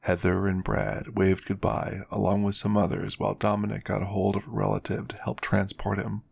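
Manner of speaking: ordinary delivery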